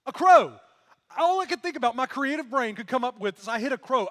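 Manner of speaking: loud, high-pitched disbelief; incredulous outburst. Acted. Preacher ridicules his teenage lie to the congregation, incredulous shout. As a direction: exclaim it, mocking your own lame excuse. Mocking his own absurd excuse.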